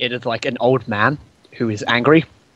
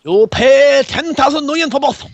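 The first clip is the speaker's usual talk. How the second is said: high, slow shopkeeper; 12 semitones higher, 4 dB louder than usual. Acted. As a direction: put on a high, slow seller. GM voices shopkeeper.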